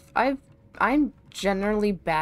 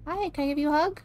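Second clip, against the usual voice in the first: cutesy pleading; 6 semitones higher, faster than usual. Real, not acted. Asking creature for hug.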